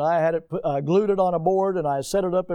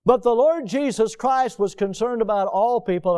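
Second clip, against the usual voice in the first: normal talking voice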